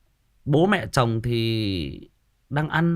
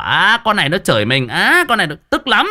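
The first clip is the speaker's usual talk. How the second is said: loud, rising mock indignation; inwardly furious; 5 semitones higher, 7 dB louder than usual. Mother-in-law silently fuming at being cursed, indignant. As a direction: seethe aloud as the offended mother-in-law. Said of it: "mặc dù trong lòng tức lắm".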